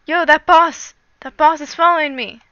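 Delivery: loud startled yelling; excited alarm. Real, not acted. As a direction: yell as the boss closes in. Boss chasing him in game.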